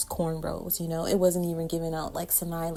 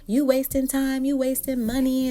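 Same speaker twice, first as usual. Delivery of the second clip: higher scolding mom voice; mad mom; 8 semitones higher, 4 dB louder than usual. Retold or imitated. Mom scolding daughter over latch hooks, high annoyed scold. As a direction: scold like an irritated mother. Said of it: "She's like".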